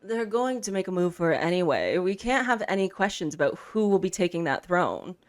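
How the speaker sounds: ordinary delivery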